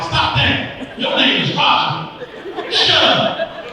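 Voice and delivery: high-pitched exasperated scolding; mock mad. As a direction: tell him off, voice shooting up. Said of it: "I been getting mad".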